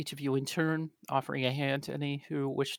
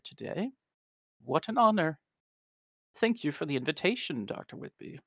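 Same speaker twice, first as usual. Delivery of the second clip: gracious society lady; 2 semitones higher than usual. Acted. Alina Hunter greets the gathered Aeon Society members, gracious and delighted. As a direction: greet the guests with effusive politeness. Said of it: "and she says".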